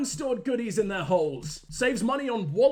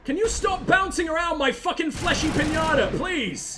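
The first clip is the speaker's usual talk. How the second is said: higher, loud annoyed yell; snapping at a squad mate in frustration; exasperated shout; 8 semitones higher, 3 dB louder than usual. Real, not acted. Player yelling at the squad mate bouncing around, exasperated.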